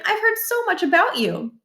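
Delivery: high, lilting mom voice; a prim suburban mother at the door; voiced mother. A narrator's reading. Mrs Barkin voiced, high.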